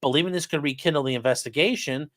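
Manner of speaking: usual speaking voice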